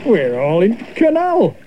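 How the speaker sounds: a flustered mayor in the water; Lord Mayor dismayed. Acted. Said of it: "the Lord Mayor cried".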